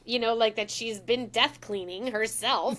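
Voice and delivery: ordinary delivery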